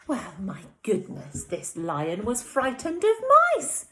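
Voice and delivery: put-on lively read-aloud to young children; animated storytime voice; story narration. A narrator's reading. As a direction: read it like a bedtime story for little ones. Teacher reads picture book aloud.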